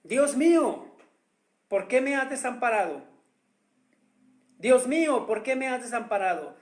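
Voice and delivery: preacher voicing Jesus crying out; crying out to God. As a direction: call out to God from the cross. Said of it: "Jesús gritó".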